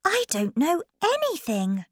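high, light character voice; Alice. Acted. Alice answering the king, high voice. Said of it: "Alice said".

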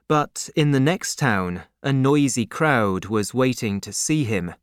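ordinary delivery

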